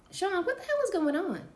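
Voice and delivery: high, loud alarmed demand. Acted. Kim demanding answers from Sean, alarmed.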